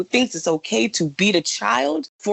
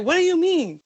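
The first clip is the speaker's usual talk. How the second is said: mock confused date; 10 semitones higher than usual. Date's retold confused question.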